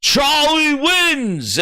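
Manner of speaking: slow, drawn-out announcer voice building up. Retold or imitated. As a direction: call the results like a host on a stage. Host announcing race results.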